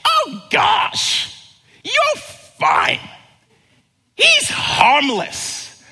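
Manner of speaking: very high, loud mocking owner voice; a jogger imitating a careless dog owner; dismissive dog owner.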